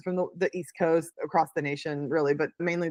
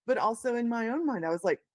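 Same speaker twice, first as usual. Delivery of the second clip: ordinary talk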